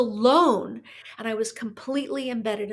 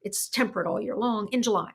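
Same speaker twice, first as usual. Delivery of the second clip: ordinary talk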